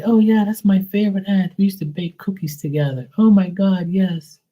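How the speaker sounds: high, gushing auntie voice, bursting with fondness. Retold or imitated. Spirit auntie gushing to the medium about her niece, high and delighted. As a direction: play the doting aunt squealing about baking cookies.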